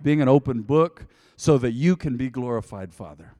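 loud preacher's prayer, building in force; fervent prayer. Real, not acted. Pastor prays over house and livestream listeners, fervent prayer. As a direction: pray aloud with swelling conviction.

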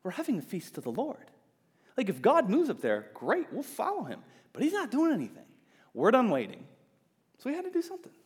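high, dismissive mock Israelite; Israelites retort. Israelites answering the warning, lightly voiced dismissive retort. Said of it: "They would say something like".

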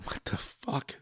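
unvoiced, disbelieving whisper. Real, not acted. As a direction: hiss it in mock disbelief. Mock disbelief aside.

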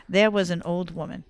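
usual speaking voice

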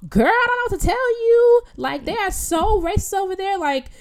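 high, emphatic mock warning; imitated insistent stranger.

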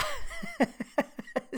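high giggling fit; helpless high laughter; laughing. Real, not acted. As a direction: crack up mid-story. Cracks up telling the story.

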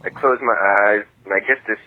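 normal talking voice

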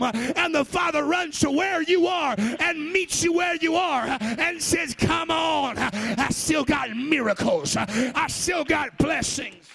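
driving, shouted altar-call preaching; full-force preaching. Real, not acted. Preacher urging sinners to turn around and come home, full-force preaching. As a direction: preach hard, stretching the key words.

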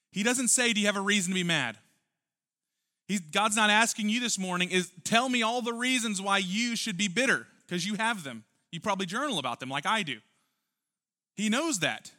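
high, loud impassioned preaching; full-force preaching. Acted. Preacher pressing the congregation, loud and fervent. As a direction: drive the point home from the pulpit.

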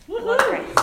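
high laughing squeak. Real, not acted. Nervous first-time speaker laughs.

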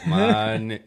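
quick Denzel impression through a laugh. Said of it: "Denzel impersonation".

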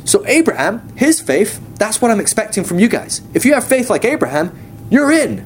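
high-pitched, eager endorsement; God, enthusiastic. Retold or imitated. God telling people what he expects of them, enthusiastic. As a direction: imitate an eager boss holding up a model, rising at the end. God holds up Abraham as example.

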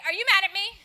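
a hint of an anxious friend; worried friend. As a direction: friend asking nervously if she's mad. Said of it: "friends that will come up to me and say".

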